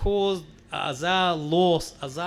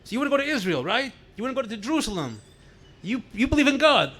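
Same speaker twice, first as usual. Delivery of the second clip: interrogator; 5 semitones higher, 4 dB louder, faster than usual. Retold or imitated. KGB agent interrogating the prisoner.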